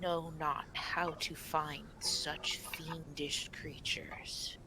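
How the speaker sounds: slow, hushed guardian voice, sinking into whisper; soft, halting whisper of an old forest spirit; whispering stag spirit. Acted. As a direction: breathe out each phrase slowly and solemnly. Game master voices the stag spirit.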